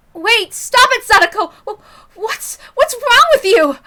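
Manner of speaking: high, loud, frantic yell to stop her; alarmed shout. Acted. Mion yelling at Sadako to stop, high frantic shout. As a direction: shout her down before she hurts someone.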